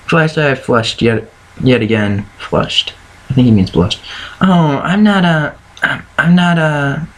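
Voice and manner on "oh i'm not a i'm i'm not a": slow, halting stammer. A narrator's reading. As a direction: stumble over the words, unsure. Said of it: "she stammered, unsure of what to say".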